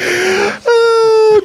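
high mock cry of pain; wounded cry. Real, not acted. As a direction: yelp like the joke stung. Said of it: "good one".

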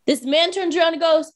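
ordinary talk, nothing marked

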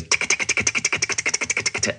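whispered tick tick mimicking distant gunfire; imitated gunfire ticks. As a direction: tap out the gunfire sound under your breath. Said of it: "you heard that noise".

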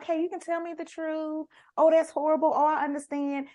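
mock-soothing guardian, high and sugary; Aunt Lydia. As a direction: mimic her syrupy fake sympathy. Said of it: "she'll be like".

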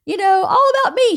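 high-pitched, hammed-up me-me-me voice; showy mock self-importance; mock attention-seeking. Mocks an attention-seeking homecoming he avoided.

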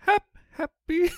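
squeaky high, drawn-out happy; high mock-giddy happy; gushing happy. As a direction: sing it like pure delight. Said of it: "Can I use vocal inflection?".

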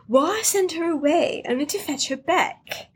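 Mister Barry in a put-on posh accent, lightly voiced; a hint of a snooty posh gentleman accent; mock posh accent. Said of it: "in his little freaking accent".

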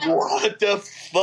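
slow, drawn-out what the fuck; stunned disbelief. Retold or imitated. Friends reacting to drunk news, slow stunned disbelief. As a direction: let the shock stretch each word.

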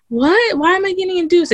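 high, alarmed "what, why"; replaying her stunned question to the nurse; shocked disbelief. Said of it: "and I'm like".

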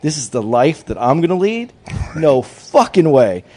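slow, drawn-out mock disbelief; incredulous refusal. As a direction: question it slowly in disbelief, then refuse emphatically. Baby rejects his father's life.

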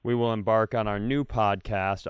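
ordinary delivery

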